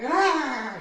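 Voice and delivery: allergy yelp. Retold or imitated. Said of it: "I'm like".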